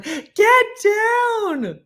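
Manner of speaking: very high, loud drawn-out yell; retold scream. Owner screaming at dog in her yard, retold scream. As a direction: scream it for the whole neighbourhood, reenacted. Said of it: "except for my voice screaming".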